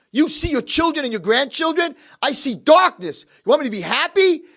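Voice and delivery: high, loud, drawn-out anguished tirade; bitter outburst. Retold or imitated. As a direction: cry out his misery at the roommate. Blind man's bitter outburst.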